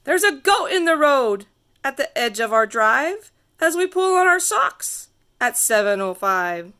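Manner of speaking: ordinary delivery, nothing marked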